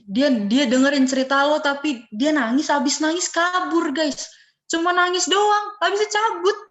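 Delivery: loud disbelief, stretching the words; exasperated protest. Real, not acted. Exasperated protest at students' answers.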